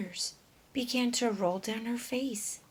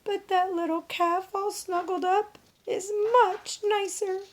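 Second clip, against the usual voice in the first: tearful; 10 semitones higher, 3 dB louder than usual. Acted. Dora sad, about to cry.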